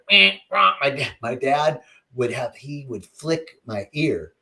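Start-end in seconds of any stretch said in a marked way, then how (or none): 0.1-0.7 mock dismissive. Family mocking him as child.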